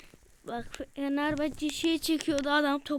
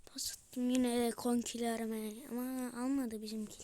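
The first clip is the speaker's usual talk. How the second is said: whisper; 5 semitones lower, 10 dB quieter than usual. Real, not acted. Fısıltı. (yavaş fısıltı)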